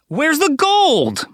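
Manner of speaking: high, slow incredulous cry; outraged surprise. A narrator's reading. Arnold staring into the empty pot in outraged disbelief. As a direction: stretch it out in disbelief.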